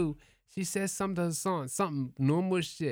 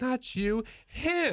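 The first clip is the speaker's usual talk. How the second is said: mock accusing girl; 8 semitones higher, much slower than usual. Drunk girl pointing him out.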